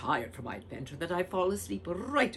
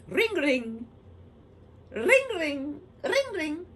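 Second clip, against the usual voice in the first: phone ring; 13 semitones higher, 8 dB louder, slower than usual. Pretend. Phone ringing sound imitated.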